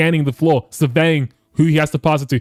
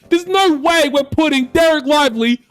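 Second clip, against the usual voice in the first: exasperated; 9 semitones higher than usual. Real, not acted. Frustrated with the matchup.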